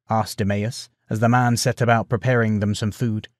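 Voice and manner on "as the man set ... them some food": ordinary delivery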